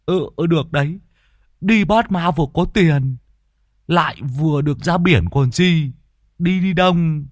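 high, eager coaxing voice; voicing Lâm Sẹo. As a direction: talk him into it quickly. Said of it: "Lâm Sẹo vội nói".

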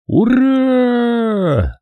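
long high drawn-out hurrah; a roar from far across the field; distant battle cry. A narrator's reading. Attacking army roaring, long high cry. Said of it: "рёв тысяч глоток".

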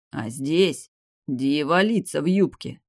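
plain reading voice

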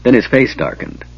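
ordinary delivery, nothing marked

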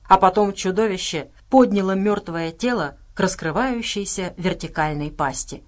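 ordinary delivery

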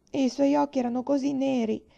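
high, loud tearful plea; a child explaining through tears; sobbing. A narrator's reading. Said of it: "singhiozzò Laura". (spiegazione singhiozzante di bambina)

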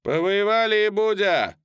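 put-on high, loud jeering young soldier; young cossack. A narrator's reading. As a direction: jeer loudly from the crowd. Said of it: "сказал молодой казак".

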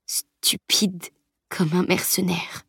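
dying breathless whisper, slow; faint stammered whisper. A narrator's reading. Dying boy mocks the mercenary, faint slow whisper. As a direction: barely get the words out, fading. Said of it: "balbutia-t-il".

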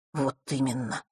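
soft, subdued sighed agreement; sighing. A narrator's reading. Boy agrees with his friend, sighing. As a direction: sigh it out quietly. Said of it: "вздохнул Ник".

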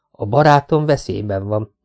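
plain reading voice

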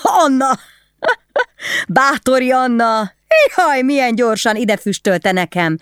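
high, mocking drunken taunt; mocking laugh. A narrator's reading. Drunk husband mocking his wife, laughing. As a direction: laugh it out, sneering at her. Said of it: "elröhögte magát".

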